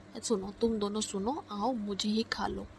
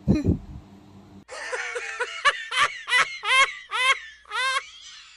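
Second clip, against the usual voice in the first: high, loud cackle of triumph; loud mocking laugh; 12 semitones higher, 7 dB louder than usual. Sister cackles at her brother in high loud mockery.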